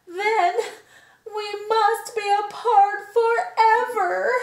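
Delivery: melodramatic lament. Acted. Gerald telling Piggy they must part forever, sung wail. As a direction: wail it like a sad opera. Gerald dramatically mourns friendship.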